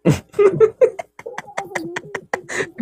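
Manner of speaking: long, very high-pitched giggle; high laughter. Real, not acted. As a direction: cackle away in a high squeal. Laughs at partner's annoyance. (matinis at mahabang tawa)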